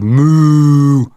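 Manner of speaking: put-on long, loud boyish moo; shouted moo. A narrator's reading. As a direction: bellow a drawn-out cow moo. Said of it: "Jeremy James shouted".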